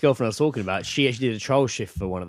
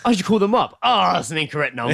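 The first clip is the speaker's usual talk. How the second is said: higher, lightly mocking quote; lightly mocking imitation; 7 semitones higher, 3 dB louder than usual. Imitating the co-host making the call, light mockery. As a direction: gently send up the friend, pitched up. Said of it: "You, you'll be like".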